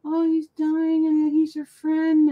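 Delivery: slow, high weepy retelling; drawn-out mock tearful voice; mock bawling. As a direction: whimper it like an imitation movie cry. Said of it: "I'm bawling".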